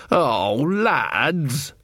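long, drawn-out groaning lads; a lone smith giving up on his snoring friends; exasperated dismay. Acted. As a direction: sag with disappointment at the sleepers. Friends have fallen asleep.

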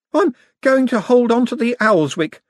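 nervous. Acted. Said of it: "said the artist nervously".

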